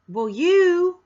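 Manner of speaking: loud, high accusing outburst; attacking tone. Shown as a demonstration. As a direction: lash out loudly as a demo of attacking someone. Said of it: "would you like me to talk to you that way".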